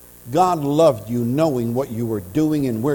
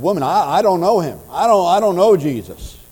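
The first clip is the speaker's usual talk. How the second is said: Peter panicking, louder and higher; frantic denial; 6 semitones higher, 8 dB louder than usual. Acted. Preacher voicing Peter's frightened loud denial. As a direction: deny it in a frightened rush.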